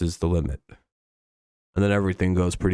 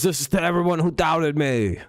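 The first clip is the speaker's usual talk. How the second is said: lightly voiced higher, earnest athlete declaration; a hint of a proud quarterback proving doubters wrong; imitating Kyle Allen; 6 semitones higher than usual. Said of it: "That's how he sounds to me".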